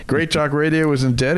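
ordinary talk

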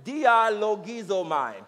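"dialogizomai" slow and drawn out; slow repeat-after-me. Shown as a demonstration. Preacher having the congregation repeat, "dialogizomai" said slowly. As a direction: lead a group through "dialogizomai" syllable by syllable.

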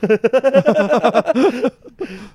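shrieking high laughter; loud high laugh. Real, not acted. Friend cracking up at the British line. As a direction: burst out laughing loudly.

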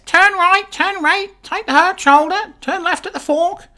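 very high squawking parrot giving directions; very high parrot sat nav voice. Acted. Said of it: "It could be great for G P S".

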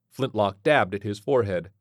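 usual speaking voice

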